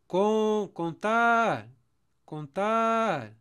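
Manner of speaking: loud, high call with "tar" held long. Shown as a demonstration. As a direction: call "Contar" like summoning someone far away. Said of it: "Percebe que eu tô chamando a palavra?".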